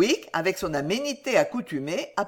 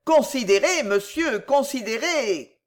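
higher, insistent appeal; earnest remonstrance; little lawyer pleading; 5 semitones higher, 3 dB louder than usual. A narrator's reading. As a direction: urge him to think, drawing out the last word. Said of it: "dit le petit Perker".

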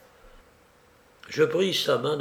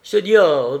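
higher, much louder outburst; lively exclamation; 4 semitones higher, 10 dB louder than usual. A narrator's reading. Felipe protests to the guest, exclaiming sharply. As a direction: cry out with quick alarm. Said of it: "dit Felipe vivement".

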